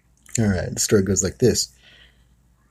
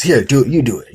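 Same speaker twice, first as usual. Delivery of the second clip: pushy, louder command; impatient dad; 5 semitones higher, 7 dB louder than usual. Retold or imitated. Dad impatiently ordering son to swing, louder. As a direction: shove the bat back at him. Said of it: "scolding the kid".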